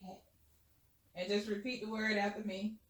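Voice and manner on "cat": soft whisper close to the ear; right in your ear. Shown as a demonstration. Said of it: "I'm gonna whisper to, in your left ear".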